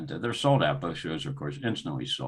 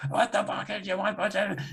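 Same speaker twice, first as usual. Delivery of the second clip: retold screaming; 13 semitones higher than usual. Boss screaming at his accountant over the phone, high-pitched mock scream. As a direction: re-enact screaming at him, pitch jumping way up. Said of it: "I start screaming at him".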